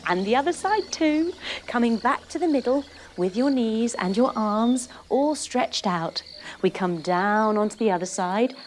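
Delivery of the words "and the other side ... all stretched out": ordinary talk, nothing marked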